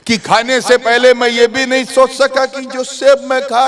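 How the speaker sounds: high, rising sermon delivery; impassioned preaching. A narrator's reading. Impassioned preacher telling a story. (जोशीला, ऊँचे सुर का बयान)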